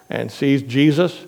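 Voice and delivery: ordinary talk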